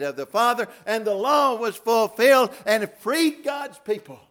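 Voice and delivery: high, loud, slow preacher's cry; full-force preaching. Acted. Preacher proclaiming to congregation, exultant and loud. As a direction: shout heaven's joy to the church.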